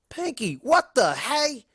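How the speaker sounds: high, slow appalled pony shriek; voiced Applejack, high and slow; Applejack screech. A narrator's reading. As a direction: screech it in disbelief. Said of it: "Applejack screeched".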